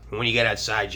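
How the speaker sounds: usual speaking voice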